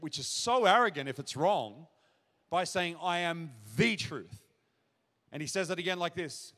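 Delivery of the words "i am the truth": "the" said long as "thee", set apart; drawn-out, emphatic "the" before truth; stress on THE. Shown as a demonstration. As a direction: lean hard and slowly on "the". Qualifies the statement with "the.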